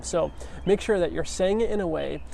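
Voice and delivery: ordinary delivery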